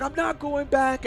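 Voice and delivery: high-pitched imitation of someone flipping out; mock freak-out. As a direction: act out a mild panicked refusal. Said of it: "the guy was flipping out".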